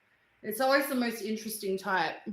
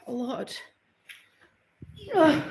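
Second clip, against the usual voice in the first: ordinary talk, nothing marked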